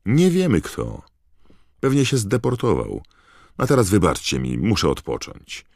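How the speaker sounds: impatient father cutting the crowd short. A narrator's reading. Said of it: "odparł niecierpliwie pan Weasley".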